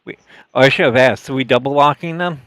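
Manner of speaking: usual speaking voice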